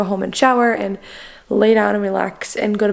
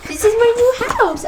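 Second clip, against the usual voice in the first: put-on high, falling baby voice; speaking for the baby; 13 semitones higher than usual. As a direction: say it again as the baby, sing-song.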